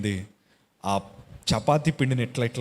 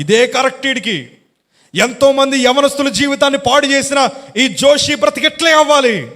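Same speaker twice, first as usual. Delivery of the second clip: much higher and louder, jeering at the sinner; mocking crowd; 12 semitones higher, 10 dB louder than usual. The world condemning the sinner, loud jeering. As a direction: jeer with loud scorn. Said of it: "ప్రపంచమంతా నన్ను ఏమందో".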